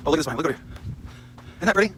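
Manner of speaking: ordinary talk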